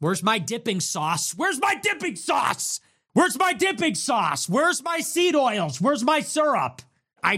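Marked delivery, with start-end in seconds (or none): 1.4-6.8 mock tantrum shout. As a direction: throw a hammed-up fit demanding the sauce. Craving fried food, demanding sauce.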